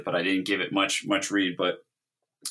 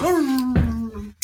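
dog whine imitation; 4 dB louder than usual. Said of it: "And he goes".

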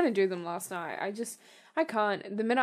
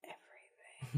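low, hushed whisper; 8 semitones lower, 16 dB quieter than usual. Pretend. Podcast guest answers a friend's question, whispering. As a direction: whisper it under your breath. Mock-dramatic answer to in what.

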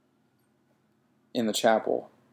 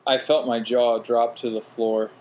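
ordinary delivery

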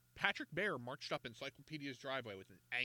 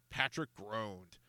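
ordinary delivery, nothing marked

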